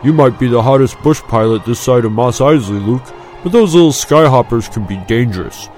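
spirited, mild warning; cautioning friend. A narrator's reading. Friend warning Luke about the Skyhoppers, cautioning. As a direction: warn a reckless mate with some push. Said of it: "his friend cautioned".

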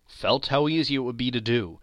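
ordinary delivery

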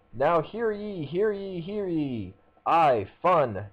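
high, ringing royal proclamation; town crier. Acted. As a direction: call it out like a herald in the square. Mascot's mock royal proclamation.

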